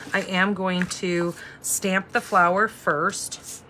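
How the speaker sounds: usual speaking voice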